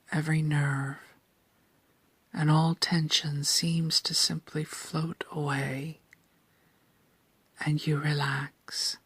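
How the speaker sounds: ordinary delivery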